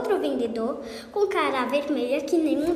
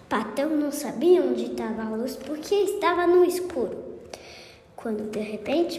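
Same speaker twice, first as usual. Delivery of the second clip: usual speaking voice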